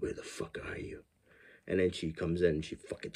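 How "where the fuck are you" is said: hissed threat under the breath; menacing whisper. Retold or imitated. Killer creeping back into the room, whispered menace. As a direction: whisper it like a stalker searching rooms. Killer hunting for hidden girl.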